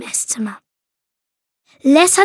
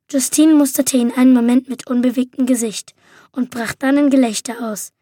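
ordinary delivery, nothing marked

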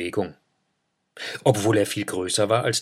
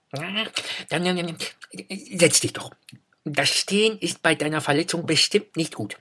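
hare voice; 8 semitones higher than usual. A narrator's reading. Hare caring for hurt Eida.

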